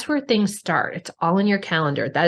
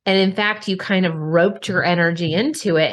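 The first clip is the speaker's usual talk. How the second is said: ordinary delivery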